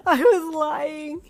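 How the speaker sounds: slow, high, loud sobbing wail. Acted. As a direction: wail it through tears of relief. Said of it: "you wailed".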